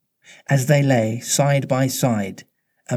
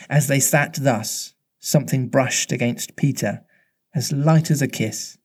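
usual speaking voice

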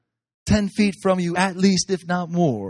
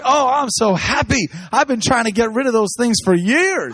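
imitating the pastor; 8 semitones higher, 4 dB louder than usual. Pastor answering the speaker, high mock delight. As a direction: play the relieved pastor, pitched up and loud. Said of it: "He's like".

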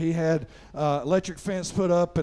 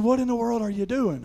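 higher-pitched retold question at normal volume; toned-down scolding; 4 semitones higher than usual. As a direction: imitate dad's exasperation without shouting. Retells dad's question with less force.

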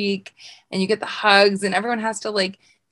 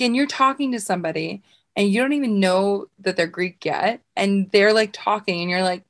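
ordinary talk

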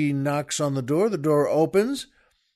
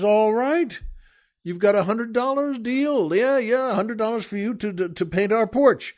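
higher-pitched, eager deal-closer; delighted agreement; 6 semitones higher than usual. Acted. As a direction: seize the bargain in a high, animated voice. Husband gleefully agrees to deal.

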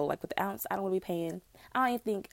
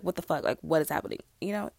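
ordinary delivery

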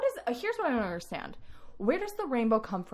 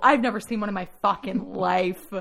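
vocal fry; 8 dB louder than usual. Real, not acted. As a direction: let the voice crackle into fry. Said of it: "do you have vocal fry?".

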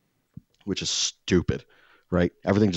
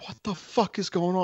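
baffled; 11 semitones higher than usual. Retold or imitated. Baffled inner reaction.

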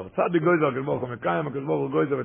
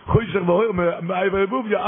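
excited retelling; 8 semitones higher, 4 dB louder than usual. Acted. Preacher retelling the gibberish climax.

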